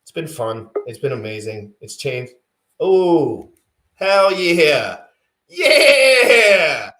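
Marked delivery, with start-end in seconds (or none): 2.8-6.9 excited cheer. Real, not acted. Guest arrives, cheering.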